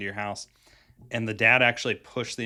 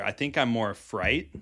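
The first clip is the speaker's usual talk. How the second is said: normal talking voice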